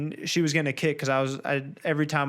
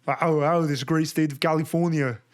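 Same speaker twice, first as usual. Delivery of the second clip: Arnold impression. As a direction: do Arnold Schwarzenegger's Austrian accent. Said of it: "my favorite's Arnold".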